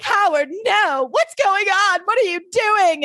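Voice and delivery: hammed-up high-pitched wailing; melodramatic, stretched-out pleading; distraught wife. Acted distraught wife from recap.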